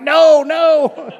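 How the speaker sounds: high, loud laughing "no, no"; laughing panic. Retold or imitated. Pastor hushing a kid spilling secrets, laughing and loud. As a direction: stop the kid, laughing and alarmed. Hand over kid's mouth, panicking.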